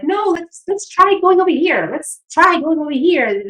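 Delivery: put-on high, bright coaxing to a shy child; coaxing mom voice. Mother coaxing her shy son to go play. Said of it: "I'm like".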